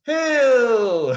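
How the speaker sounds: very long, high, loud wail; dramatic cry. Acted. Storyteller revealing boot camp, dramatic drawn-out cry. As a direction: belt out one word as a drawn-out horror reveal.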